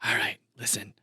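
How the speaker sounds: hushed, confidential whisper; quick secretive whisper; whispered aside. Pretend. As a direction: whisper it privately so the others can't hear. Pulls colleague aside for private word.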